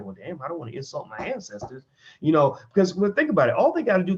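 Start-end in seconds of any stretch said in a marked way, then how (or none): 0.0-1.8 guilty mumble. Retold or imitated.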